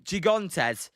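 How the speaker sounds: insisting on the Spanish "Gigantes". Shown as a demonstration. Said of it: "No, it's Spanish".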